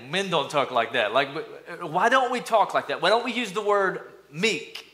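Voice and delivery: ordinary talk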